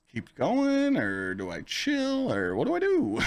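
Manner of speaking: high, slow, drawn-out dithering; mock bewilderment. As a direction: act out being comically torn, voice swooping up. Acting out his confusion while kissing.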